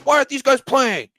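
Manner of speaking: high, loud outraged fan shout. Retold or imitated. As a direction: yell it like a furious sports fan. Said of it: "everyone's like".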